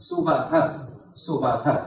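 Suvaha in separate syllables, su-va-ha; careful pronunciation. Shown as a demonstration. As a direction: model Suvaha with a sounded final HA. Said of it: "So remember it is Suvaha".

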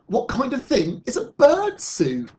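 high, loud puzzled question; incredulous child's question in a story; baffled questioning. Acted. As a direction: ask in bewilderment, pitch leaping up. Others question Sue's new idea.

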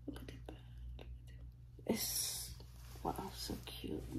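hushed, breathless whisper of awe; soft amazed whisper; awed whisper. Real, not acted. Unboxing a reborn baby doll, hushed awe.

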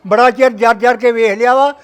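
ordinary talk, nothing marked

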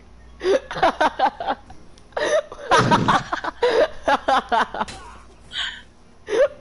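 loud high wheezing laugh; crying with laughter; hysterical laughter. Real, not acted.

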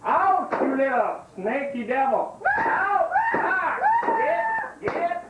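yelling high at a critter; high shouting. Acted. Whipping iguana out of hole.

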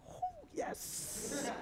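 tiny high falsetto cheer, very soft; barely audible high-pitched mock rejoicing; hushed delight. Privately delighted at mother's supposed complaint.

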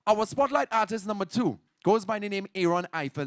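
ordinary delivery

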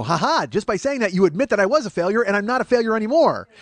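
loud, high mock triumph; triumphant gotcha. Comic catches his consolers out, triumphant gotcha. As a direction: pounce on their slip and gloat. Acted gotcha in a retold argument.

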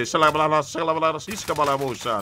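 loud babbling gibberish; mock speaking in tongues. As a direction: rattle off nonsense like tongues. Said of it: "speaking in tongues".

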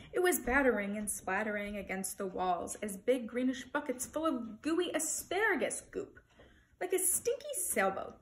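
ordinary delivery, nothing marked

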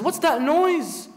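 annoyed tyrant. Acted. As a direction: bark it out, irritated at being woken. Yazid woken by the yelling.

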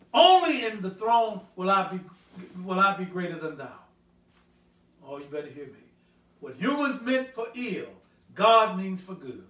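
emphatic pulpit delivery, hitting key words; full-force preaching. Acted. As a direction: proclaim the verse to the congregation.

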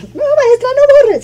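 very high, squeaky mock-pupil voice; imitated high-pitched student plea; pleading pupils. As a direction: whine like schoolkids begging the teacher. Mimics pupils pleading not to erase.

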